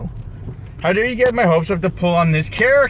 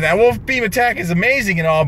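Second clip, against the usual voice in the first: ordinary talk, nothing marked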